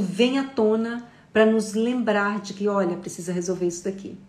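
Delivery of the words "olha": ordinary talk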